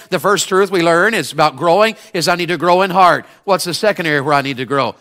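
usual speaking voice

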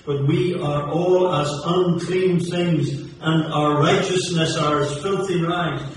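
preacher warning the lost about the devil, fervent and loud; fiery preaching. Acted. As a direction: thunder it at the congregation. Scripture then rising sermon.

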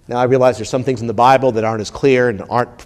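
normal talking voice

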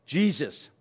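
ordinary talk

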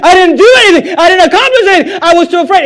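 high, loud, pleading excuse; mock servant's excuse. Acting the lazy servant.

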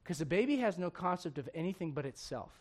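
usual speaking voice

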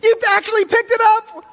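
shrill panicked nurse gasping at the nose; freaked-out nurse. Retold or imitated. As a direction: squeal it like a horrified nurse. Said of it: "like, freaked out".

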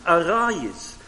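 usual speaking voice

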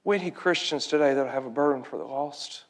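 low, hushed pulpit appeal; solemn preaching. Real, not acted. Preacher asking Christians about the lost, solemn and low. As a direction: bring it down low for an earnest question.

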